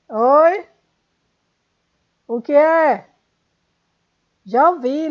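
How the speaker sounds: loud, high call to someone in another room. Real, not acted. Teacher interrupted, shouting back to Luiz offscreen. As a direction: answer a call from across the house.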